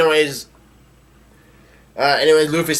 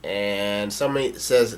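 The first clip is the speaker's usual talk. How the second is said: usual speaking voice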